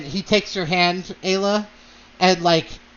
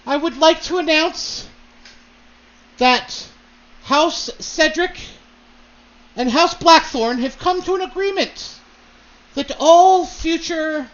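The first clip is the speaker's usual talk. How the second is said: proud announcement; 9 semitones higher, 4 dB louder than usual. Acted. Lord announces the house alliance to the ballroom, loud proud proclamation. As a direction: announce it to the whole ballroom, pitched up and ringing. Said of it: "And he says".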